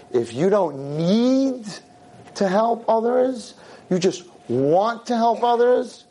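NEED and WANT drawn out, pitched high; emphatic stretched words. Real, not acted. Preacher stresses need against want.

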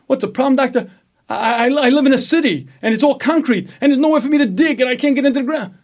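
a desperate creature pouring out his troubles; patient complaining to doctor, high, loud and hurried; frantic worm patient. Acted. Patient explains plight to doctor.

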